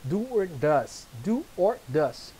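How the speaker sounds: "Do or does" stretched out word by word; teacher drilling "do or does" slowly. Shown as a demonstration. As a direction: spell out "Do or does" slowly for the class. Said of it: "esses caras aqui no começo são verbos auxiliares".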